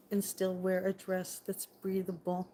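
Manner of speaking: usual speaking voice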